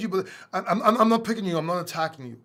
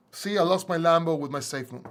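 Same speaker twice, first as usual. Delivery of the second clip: ordinary delivery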